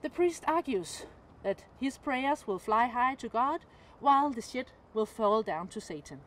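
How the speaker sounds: ordinary talk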